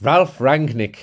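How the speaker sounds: "Ralf Rangnick" loud, German R's copied; practised German pronunciation. Shown as a demonstration. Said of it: "You've got it".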